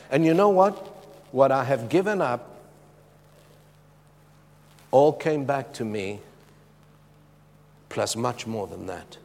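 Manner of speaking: ordinary talk, nothing marked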